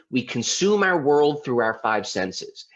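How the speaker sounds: ordinary delivery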